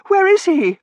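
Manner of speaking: high, loud, panicked question; frantic cry. A narrator's reading. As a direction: search frantically for a buried man. Searching rubble for buried warden.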